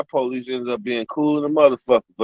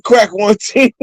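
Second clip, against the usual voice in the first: mock officer order; 8 semitones higher than usual. Officer orders door opened.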